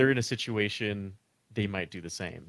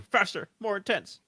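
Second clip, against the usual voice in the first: higher, pushy director's call; mock director; 10 semitones higher, 4 dB louder than usual. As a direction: bark it like a director egging him on. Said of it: "He's over there telling him".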